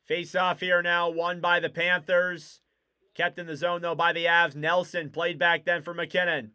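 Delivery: brisk hockey call, level and steady; live hockey commentary; play-by-play. Acted. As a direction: keep the play moving like a broadcaster. Resumed play-by-play.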